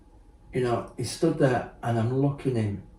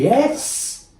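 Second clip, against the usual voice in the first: a high, loud burst of agreement; excited yes; 8 dB louder than usual. Real, not acted. Agreeing eagerly with the interviewer, loud high excited yes.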